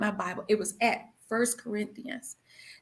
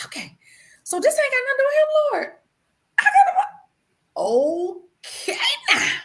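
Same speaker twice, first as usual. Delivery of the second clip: fast, high, excited rush; relieved realization; 14 semitones higher, 5 dB louder than usual. Retold or imitated. Wife realizing the verse isn't about him, fast and high. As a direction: tumble it out in relief. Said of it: "wiping my tears".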